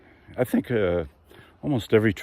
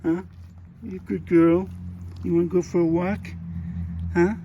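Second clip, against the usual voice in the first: high squeaky doggy talk; squeaky praising a dog; 10 semitones higher than usual. Acted. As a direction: gush over a good girl.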